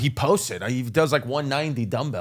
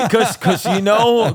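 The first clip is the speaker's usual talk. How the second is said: laughing; 6 semitones higher, 6 dB louder, slower than usual. Real, not acted. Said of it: "no, I'm kidding".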